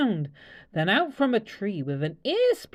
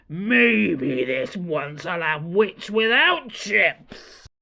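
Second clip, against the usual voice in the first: slow, relishing dragon voice, a touch lower; greedy dragon; 4 semitones lower, much slower than usual. Acted. Dragon deciding to eat the witch, slow relish. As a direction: savour every word like a meal. Dragon licking lips, scheming.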